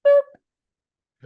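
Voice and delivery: very high, loud squeaky boop; a cute, playful poke of a sound; squeaky cartoon boop; game character boop. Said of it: "Oh, and".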